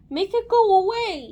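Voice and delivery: high, loud plea; man's yell. A narrator's reading. Man begs Aaron about the hair, yelling. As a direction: yell to be rid of it. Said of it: "yelled the man".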